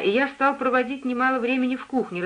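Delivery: plain reading voice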